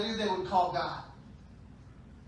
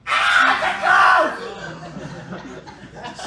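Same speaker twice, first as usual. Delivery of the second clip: scared scream; 2 semitones lower, 12 dB louder, much slower than usual. Acted. People scared when Jesus comes different, frightened scream. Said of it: "we get scared and we go".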